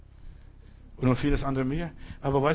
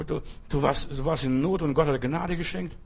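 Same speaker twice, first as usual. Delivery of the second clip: normal talking voice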